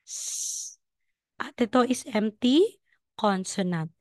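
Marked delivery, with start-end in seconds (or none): 0.0-0.8 "S" as a long voiceless hiss; a drawn-out hiss for "S"; hissed s sound. Shown as a demonstration. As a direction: breathe out "S" as a thin hiss, no voice. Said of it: "Pag ganyan, letter S".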